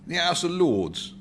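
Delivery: slow, fond recollection; old man recalling the past, slow and fond; reminiscent. Acted. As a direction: drift back in memory. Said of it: "put in the old man reminiscently".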